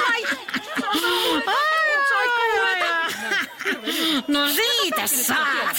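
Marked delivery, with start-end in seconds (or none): 0.3-4.2 long high wicked cackle, half shouted; evil cackle. Acted. Wicked granny cackling at passers-by below. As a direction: cackle with glee at the victims below. Granny throws nuts onto street.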